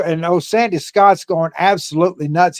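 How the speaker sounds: normal talking voice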